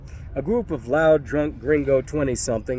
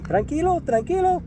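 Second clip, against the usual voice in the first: much higher, pleading calm-down; placating. Retold or imitated. Scared thug placating the narrator, high and pleading. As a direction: backpedal with raised hands in your voice. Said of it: "put his hands out placatingly".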